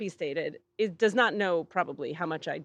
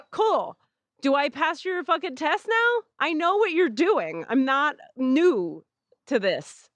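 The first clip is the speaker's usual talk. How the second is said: exasperated retort; 7 semitones higher, 4 dB louder than usual. Retold or imitated. Retorts angrily at Andron's test.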